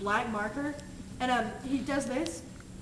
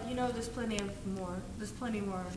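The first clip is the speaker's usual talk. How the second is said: quiet retold hint; 5 semitones lower, 10 dB quieter than usual. Retold passengers pointing out free seats.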